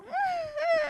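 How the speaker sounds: high nasal-free whimper, mock dog; mimicked dog whine. Narrator imitating her dog whining for attention. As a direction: copy the dog's little whines.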